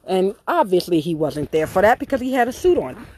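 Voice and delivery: ordinary delivery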